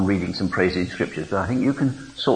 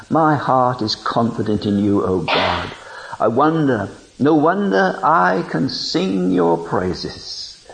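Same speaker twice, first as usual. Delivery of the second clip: fervent reading of scripture; 5 semitones higher, 3 dB louder than usual. Acted. Preaching praise scripture.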